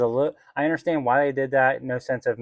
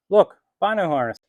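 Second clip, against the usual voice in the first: excited; 8 semitones higher, 5 dB louder than usual. Real, not acted. Showing off the harness on.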